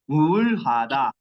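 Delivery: Уулхада drawn out slowly syllable by syllable; slow drilled word. Shown as a demonstration. Teacher drilling Уулхада slowly for class. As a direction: spell out Уулхада slowly for students. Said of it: "Уулхада деп жаттагыла".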